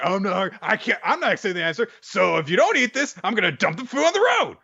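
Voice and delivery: a hammed-up granny refusing to take no; stubborn old woman. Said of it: "And then you're like".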